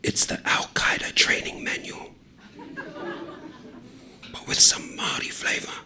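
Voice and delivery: fully whispered, conspiratorial; hushed conspiratorial whisper. A narrator's reading. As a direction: breathe it like a secret across the table. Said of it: "leans in even closer".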